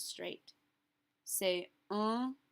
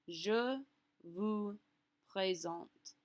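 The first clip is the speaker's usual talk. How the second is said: slow French, a gap before "présente"; formal phrase demo; much slower than usual. Shown as a demonstration. Teacher modelling the formal French introduction, slow and separated. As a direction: model it slowly, word by word. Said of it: "flip out the te for a vous".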